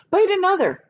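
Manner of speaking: high, loud command to the centipede; firm order. Acted. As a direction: order it sharply. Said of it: "James ordered".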